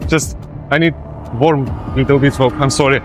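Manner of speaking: ordinary talk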